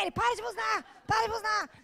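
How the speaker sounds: high, urgent imitated plea; mock protesting shout. Boyfriend begging girlfriend to stop honking, mock shout.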